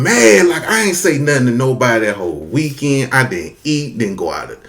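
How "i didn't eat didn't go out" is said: normal talking voice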